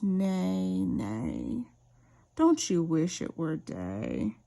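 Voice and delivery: horse neigh. A narrator's reading. Wise mare neighs answer.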